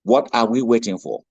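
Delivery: ordinary delivery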